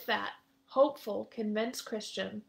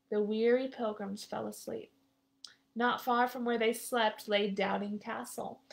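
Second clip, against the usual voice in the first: plain reading voice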